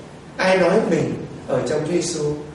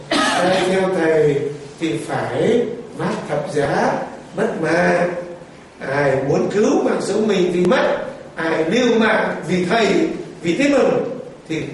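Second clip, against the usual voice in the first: master's words; much slower than usual. Acted. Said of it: "nhớ lại lời thầy đã nói".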